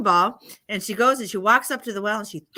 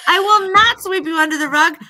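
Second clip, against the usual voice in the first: put-on loud, high, defiant little girl; indignant refusal; 8 semitones higher, 9 dB louder than usual. Good girl refuses to sweep dirt under rug, indignant. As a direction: refuse at full voice, pitched up.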